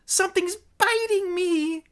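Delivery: very high cry of pain; shrieking. Acted. Bitten woman crying out to the gang, shrieking. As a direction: shriek it in pained alarm. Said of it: "shrieked one of the women".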